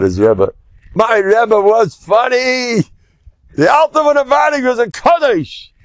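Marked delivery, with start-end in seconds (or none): 0.9-2.8 higher, slow and savoring; a touch of the old rabbi's chuckling fondness; fond amused quote. Retold or imitated. Quoting the rabbi's fond memory. 3.6-5.7 much higher put-on voice, relishing it; hammed-up elderly rabbi marveling; mock old-rabbi voice. Quoting the rabbi's fond memory.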